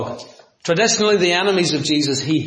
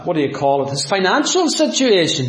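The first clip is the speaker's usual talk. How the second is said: ordinary talk, nothing marked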